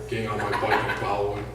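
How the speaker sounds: ordinary delivery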